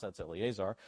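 ordinary talk

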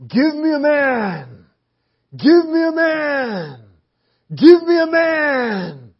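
the giant Goliath roaring his challenge at Israel, loud yell; giant's yell. Retold or imitated. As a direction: bellow it across the valley like the giant. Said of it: "and he's yelling".

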